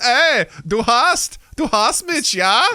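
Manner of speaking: high, hammed-up mock German; exaggerated German, pitched high; German accent. Shown as a demonstration. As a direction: put on a big German accent.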